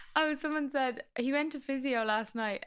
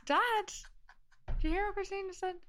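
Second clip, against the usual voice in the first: high-pitched call to a parent; mock calling out; 12 semitones higher than usual. Daughter pretends to ask Dad for friend's flight, mock calling. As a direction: playfully pipe up as if Dad can hear.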